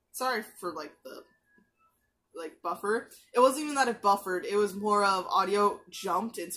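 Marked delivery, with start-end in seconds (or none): none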